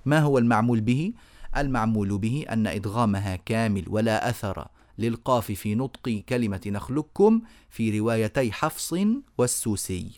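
ordinary delivery

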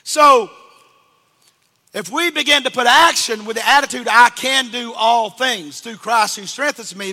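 loud, high sermon voice; preaching at full force. Acted. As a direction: drive it home to the congregation.

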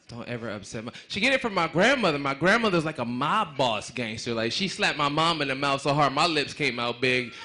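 ordinary talk

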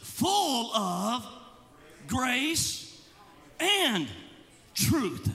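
each word set apart by pauses; measured scripture emphasis; slow emphatic reading. Shown as a demonstration. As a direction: lean on grace and truth, pausing between them. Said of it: "Look at this".

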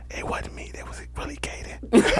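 unvoiced whisper, slow at the end; mock whisper. Little brother whispering to blame his older brother. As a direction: whisper it like a sneaky kid blaming his brother.

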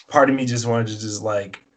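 normal talking voice

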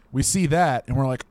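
usual speaking voice

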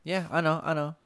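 loud, high defensive brush-off; exasperated mock retort. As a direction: brush them off, annoyed. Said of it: "I'm like".

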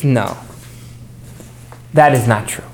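low, measured refusal; lightly acted, low-pitched dismissal; dismissive verdict. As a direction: turn it down from above, pitch dropped. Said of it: "looks to the lower level and says".

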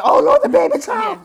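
high, loud, hammed-up shriek; mock scream. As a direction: shriek it in mock horror at a baby-shaped cake. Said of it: "I can see myself screaming".

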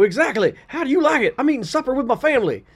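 loud, high, huffy protest; indignant dad. Acted. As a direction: huff like an offended father. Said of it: "And I go".

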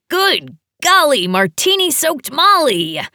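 high, loud and gleeful; excited greeting. A narrator's reading. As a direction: burst out with delight at seeing her. Eddie greets her with hug.

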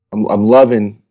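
ordinary delivery

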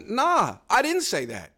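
drawn-out nah, shaking it off; mock self-quote, slow and dismissive; dismissive denial. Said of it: "I'm like".

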